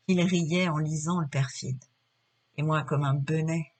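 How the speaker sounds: low, somber theatrical lament; très sombre. Acted. Figaro alone in the dark, railing at deceiving women, somber. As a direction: brood aloud over a woman's betrayal, pitch sinking. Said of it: "dit du ton le plus sombre".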